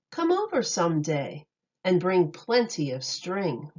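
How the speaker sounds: ordinary delivery, nothing marked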